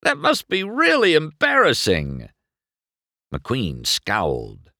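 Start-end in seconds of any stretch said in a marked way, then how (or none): 0.0-2.3 high, loud mocking voice; smug rival gloat; taunting rival. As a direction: rub it in. Chick taunting.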